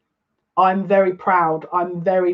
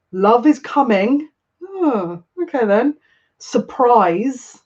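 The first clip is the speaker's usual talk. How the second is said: slow card reading; 5 semitones higher, much slower than usual. Retold or imitated.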